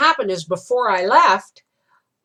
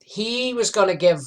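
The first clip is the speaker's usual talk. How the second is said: ordinary delivery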